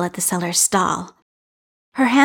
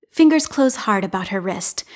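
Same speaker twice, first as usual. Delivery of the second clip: ordinary delivery, nothing marked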